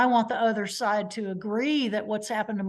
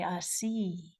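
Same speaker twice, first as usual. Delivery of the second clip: softly imitated wily old lawyer; a hint of a knowing old lawyer, quietly; old lawyer impression; 3 semitones lower, 8 dB quieter than usual. Old lawyer over his glasses, quietly knowing. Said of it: "slide his glasses down his nose".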